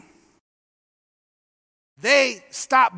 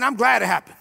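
lowered, gentle voice of God; God speaking gently; 5 semitones lower than usual. Retold or imitated. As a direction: speak it low like God reassuring. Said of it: "God's saying".

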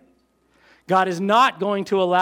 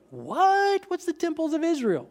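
mock surprise; 7 semitones higher than usual. Preacher feigns shock at verse.

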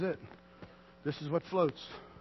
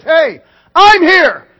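big, slow, booming call much higher and louder; loud reassurance; 10 semitones higher, 20 dB louder, much slower than usual. Acted. Preacher voicing Jesus calling out to the troubled. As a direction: call it out like Jesus across the storm. Jesus answers the storm-tossed.

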